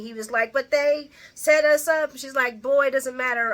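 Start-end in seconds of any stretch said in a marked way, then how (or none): 0.5-2.1 protesting. Retold or imitated. Quoting character's protest. 2.6-3.5 dismissive. Retold or imitated. Quoting Ava dismissing him.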